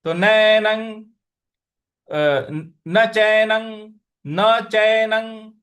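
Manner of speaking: नैनं and चैनं ending in ang, slow and firm; pronunciation demo. Shown as a demonstration. Teacher repeats the words slowly, showing the ang ending. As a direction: land each word on a nasal ang. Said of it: "उसका उच्चारण हमें अंग ले आना है".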